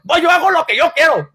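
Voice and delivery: defiant wife. Retold or imitated. Wife talks back to husband.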